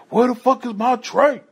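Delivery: high mock yell from an angry inmate. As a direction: shout it out like a furious hungry prisoner.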